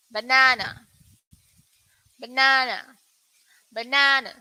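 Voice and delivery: banana with a long open "ah" in the middle, bə-NAH-nə; British pronunciation. Shown as a demonstration. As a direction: model the British banana for learners. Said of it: "this one is for U K".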